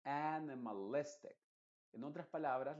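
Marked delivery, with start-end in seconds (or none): 0.1-1.4 slow animalistic, AN and LIS both stressed. Shown as a demonstration. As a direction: stretch animalistic out, hitting AN and LIS. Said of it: "las dos que tienes que enfatizar". (animalistic lento, marcando AN y LIS)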